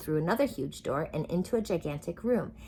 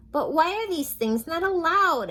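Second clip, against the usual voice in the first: put-on cheerful girl voice; 11 semitones higher, 8 dB louder than usual. Sunshine's bright child voice.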